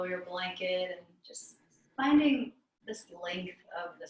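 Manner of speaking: calm meditation teacher with long pauses; guided meditation. Real, not acted. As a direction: guide them inward, unhurried, leaving long silences between phrases.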